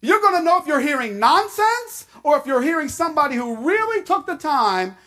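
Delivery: urgent pulpit exhortation; preaching at full force. Acted. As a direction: press the point home.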